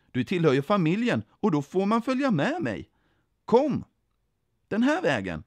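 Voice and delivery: high, loud, swooping enthusiasm; eager invitation. A narrator's reading. Jesus bjuder in familjen, eager and high. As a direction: urge them cheerfully to come along.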